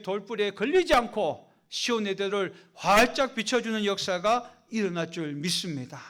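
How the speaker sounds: loud, driving sermon delivery; fervent preaching. Acted. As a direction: preach it out with full force. Preaching with rising fervor.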